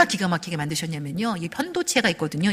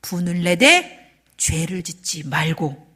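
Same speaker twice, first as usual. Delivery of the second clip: slow scripture quote; 3 semitones lower, slower than usual. Acted.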